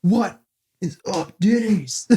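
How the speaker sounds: high-pitched, drawn-out mock shoutout; high-pitched comic greeting; hype shoutout. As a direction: put on a high, goofy greeting. Quotes a rowdy shoutout.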